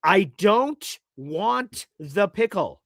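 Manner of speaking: each word slow and deliberate; over-clear slow insistence; slow emphatic. Retold or imitated. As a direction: spell it out slowly for the clerk. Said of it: "let me make this as clear as possible".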